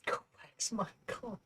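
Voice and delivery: hushed, breathy mock order; whispered mock master. Echoing the friend's Miyagi line, hushed whisper. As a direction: whisper it like a secret teaching.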